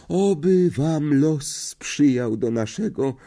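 high, slow, faint swooning farewell. A narrator's reading. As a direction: sigh out a languid goodbye. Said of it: "omdlewającym tonem".

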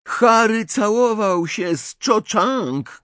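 high, drawn-out cry; tearful shout. A narrator's reading. Ginny throws secrets at Ron, shouting near tears. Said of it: "krzyknęła Ginny, teraz już bliska łez".

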